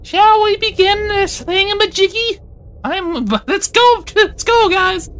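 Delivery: silly high judge voice. Pretend. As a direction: open the court in a goofy squeaky voice. Judge opens court in silly voice.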